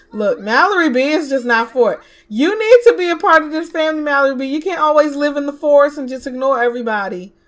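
loud telling-off to a character; loud scolding. Real, not acted. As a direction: tell her off to join the family. Scolding a Sim to stay.

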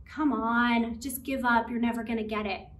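dismissive friend putting someone down; discouraging friend. Acted. The unhelpful friend beside someone struggling, discouraging. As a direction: write them off, no lift in the voice. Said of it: "That makes me feel discouraged and hurt".

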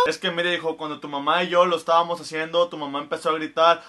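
deep-voiced father answering his son. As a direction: explain like an embarrassed dad. Father explaining.

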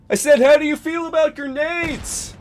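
very high, loud, straining yell; hammed-up hollered question; shouted repeat. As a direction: repeat it as if they're hard of hearing. Repeating louder after "What?.

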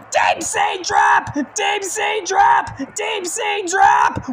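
high, slow announcer yell; drawn-out hype shout. Acted. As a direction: stretch out the move's name. Calling the finisher.

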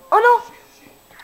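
sudden high shout of dismay; real dismay. Real, not acted. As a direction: yelp as the mistake lands. Accidentally hits the hostage.

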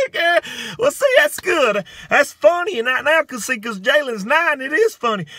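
high, loud comic exclaiming; mock amusement. Comedian mocking mama and auntie finding it funny, high-pitched mock glee. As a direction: keep the pitch way up, as if tickled.

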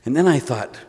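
ordinary delivery

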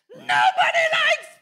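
high, slow, crying shout; exasperated shriek. Retold or imitated. Mom spinning round to the back seat, crying shriek. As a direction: snap back over the seat, at breaking point. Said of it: "She's like".

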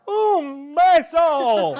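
very slow, high, loud playful kiss; drawn-out kiss. Acted. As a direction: stretch it out teasingly. Playful sign-off.

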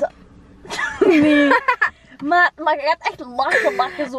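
laughing. Real, not acted. Laughing while arguing.

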